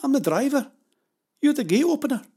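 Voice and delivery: high, loud protest; insistent arguing. A narrator's reading. Pavel telling Jenny the gate is her job, insistent. Acted quarrel over the gate.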